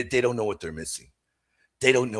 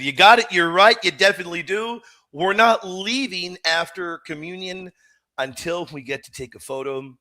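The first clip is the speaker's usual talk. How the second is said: retold emphatic promise, pitched higher; emphatic agreement; 7 semitones higher, 6 dB louder than usual. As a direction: agree firmly, as if reenacting a promise. Retold firm promise to daughter.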